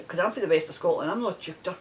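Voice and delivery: usual speaking voice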